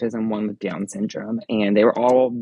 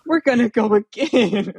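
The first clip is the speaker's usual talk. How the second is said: high-pitched, eager kid impression; imitated little boy; 12 semitones higher, 4 dB louder than usual. Little boy announcing another ride, high imitated kid voice. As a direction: mimic a small child's happy announcement. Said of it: "He was like".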